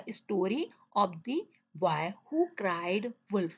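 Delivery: very slow, word-by-word read-aloud; teacher reading a sentence very slowly to the class; slow dictation. Shown as a demonstration. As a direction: spell it out slowly for young students. Teacher reads answer slowly.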